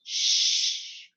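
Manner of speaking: unvoiced "sh" held long on its own; isolated sh sound. Shown as a demonstration. Teacher showing the digraph's phoneme, isolated sh sound. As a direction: say "sh" as one drawn-out hushing sound. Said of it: "to make that one sound".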